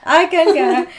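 hammed-up British accent. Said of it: "With a weird accent".

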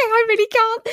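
high squeal of giggles; helpless high-pitched giggly protest; laughing. Real, not acted. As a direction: give up, cracking up. Breaking up over failed accent.

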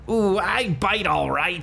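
high, slow accented growl on "Ooh, I bite all right."; put-on tough-guy accent. Shown as a demonstration. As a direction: lean hard into the accent on request. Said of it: "Let me hear that with an accent".

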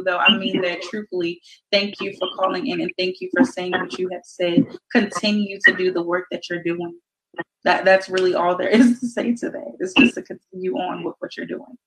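ordinary delivery

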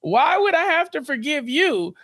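slow, high, affronted question; incredulous indignation. Acted. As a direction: draw it out in acted disbelief. Acts mom's head spinning around.

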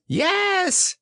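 very high, squeaky little voice; gleeful little child. A narrator's reading. Little Roo answering his mother, gleeful squeak. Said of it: "said Roo".

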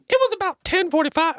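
very high laughing attempt at a voice; male voice attempt. Friends ask for a male voice, he breaks laughing. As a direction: crack up mid-line trying a voice. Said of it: "it has to be male voice".

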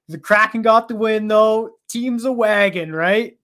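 hyped, high and loud; frat bro. Real, not acted. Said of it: "bringing it".

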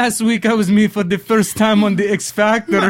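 mock Caribbean accent drifting into Irish; attempted island accent, sounding Irish. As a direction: have a go at an island accent and miss. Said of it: "He sounds Irish".

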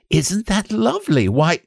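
put-on very high little boy's wonder; high-pitched childish admiration; delighted. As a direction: marvel at it like a thrilled child. Boy delighted by butterfly.

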